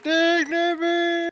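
high mock voice. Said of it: "He's like".

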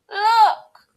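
very high, loud cub shout; Baby Bear. A narrator's reading. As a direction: cry out in little-bear alarm. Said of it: "cried Baby Bear".

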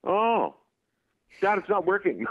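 put-on high little-child voice; unimpressed kid. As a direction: mimic a child complaining to Dad. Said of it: "the kid looks at me, and he goes".

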